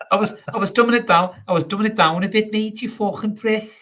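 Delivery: exaggerated Liverpool accent, pitched right up; Scouse accent. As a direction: show off a squeaky-high Scouse put-on. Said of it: "let's see your Scouse accent".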